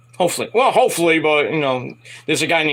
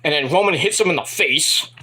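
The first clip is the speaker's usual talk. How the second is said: ordinary talk, nothing marked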